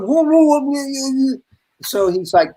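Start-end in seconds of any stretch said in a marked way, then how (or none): 0.0-1.4 high, babbled string of protest; hammed-up flustered spluttering; mock alarm. Retold alarm under accusing looks.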